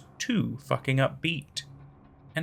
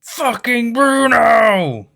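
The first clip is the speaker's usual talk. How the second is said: furious outburst; 9 semitones higher, 9 dB louder, much slower than usual. Acted. Realizing Bruno tricked her.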